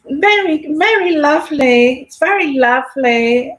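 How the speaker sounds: mock-regal genteel voice, starting high and easing down; Queen's voice. Vlogger showing what is regal for the Queen, mimicked royal voice. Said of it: "it's regal for, for the Queen to".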